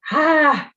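short, high, loud "ah" of frustration; pent-up scream, briefly released; mock scream. A narrator's reading. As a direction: let out a contained scream. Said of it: "I silently scream".

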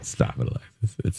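hushed playful reprimand; whispered mock scold. Host to cohost, whispered mock warning. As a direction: hiss it under your breath in jest.

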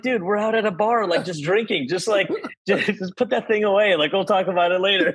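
high-pitched, laughing mock protest; retold protest. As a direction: act out telling a buddy to stop, amused. Acted protest to friend exposing himself.

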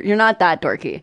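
ordinary talk, nothing marked

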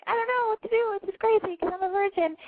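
put-on high, sweet little-boy voice; cute kid voice. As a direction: play an innocent young boy telling his story. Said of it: "It's way cuter when you're like".

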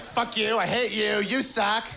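high, mock-furious snarling; hammed-up rage at nothing; angry blogger. Feminist blogger snapping at the world, high mock fury.

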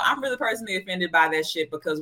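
ordinary delivery

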